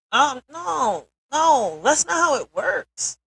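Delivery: high, loud, slow emphatic no; angry rebuttal. Real, not acted. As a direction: shout it down, slowly and loud.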